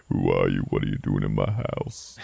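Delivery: deep, menacing homeowner; low growled interrogation; Stephen Lang. Retold or imitated. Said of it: "I love his voice".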